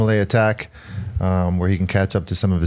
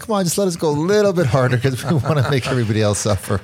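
much higher, put-on begging voice; pleading rider; 6 semitones higher than usual. Riders begging Lance to go harder downhill, high mock plea. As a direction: beg for more speed with a laugh breaking in. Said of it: "And be like".

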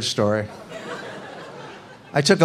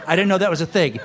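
ordinary delivery

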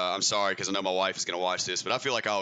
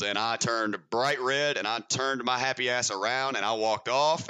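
ordinary talk

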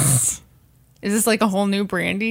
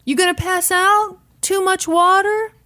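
high-pitched mock questioning; radio DJ; 11 semitones higher, 4 dB louder than usual. DJ questioning the sick contestant, high put-on mock concern. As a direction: imitate a DJ needling a contestant, voice pitched up. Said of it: "D J".

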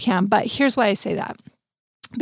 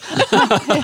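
hearty laugh; 5 semitones higher than usual. Real, not acted. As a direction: burst of real laughter. Laughs at [name] joke.

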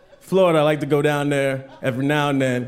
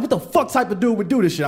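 retold indignation, pitched up; pissed off; 4 semitones higher than usual. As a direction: act out being outraged at the guy. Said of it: "I was fucking pissed off".

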